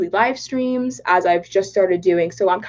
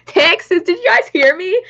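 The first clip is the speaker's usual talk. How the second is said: Texas country accent; 10 semitones higher, 5 dB louder than usual. Real, not acted. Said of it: "Texas in a country accent".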